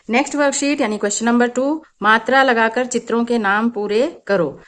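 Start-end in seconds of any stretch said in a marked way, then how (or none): none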